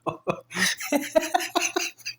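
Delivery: real high-pitched laughing fit; high cackle. Real, not acted. Storyteller cracks up at his own retold insult, high laugh. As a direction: crack up helplessly, high and long.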